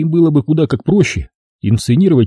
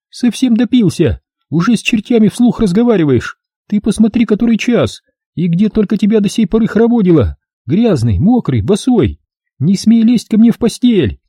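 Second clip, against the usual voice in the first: higher scolding wife's voice; 10 semitones higher than usual. Acted. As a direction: nag the drunk husband sharply. Scolding wife voiced by narrator.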